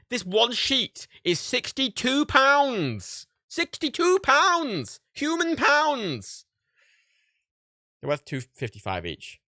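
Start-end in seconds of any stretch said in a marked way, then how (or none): 0.1-5.0 drawn-out, much higher and louder cry of disbelief; shocked disbelief. Real, not acted. Shocked at the sticker price. 5.1-6.5 Human pounds drawn out slowly, much higher and louder; shocked disbelief. Real, not acted. Shocked at the sticker price.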